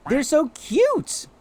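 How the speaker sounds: very high, slow cooing; squeaky slow gush at the cute creatures; cooing baby voice. Acted. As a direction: melt over them in a high slow coo.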